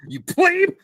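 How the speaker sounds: high, loud playful outburst; hammed-up scorn at an inferior; high-pitched mock disdain; mock snobbish insult.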